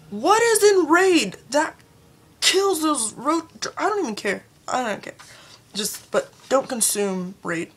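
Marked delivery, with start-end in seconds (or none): 0.1-3.5 loud, high, drawn-out demand; raised voice, slow exasperated question; incredulous outburst. Real, not acted. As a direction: demand it slowly in disbelief. Exasperated rhetorical question about Raid.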